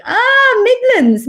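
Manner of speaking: high, loud drawn-out "Ah"; exaggerated dawning approval; mock relieved realisation. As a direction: exclaim it high, as if it all makes sense. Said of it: "I would have said Midlands area".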